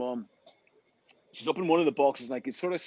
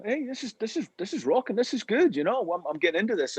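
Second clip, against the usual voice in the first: higher, lively impression of a fan warming up; excited crowd member; 6 semitones higher than usual. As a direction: voice the crowd getting hooked. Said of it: "people were going".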